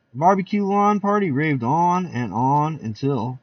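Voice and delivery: plain reading voice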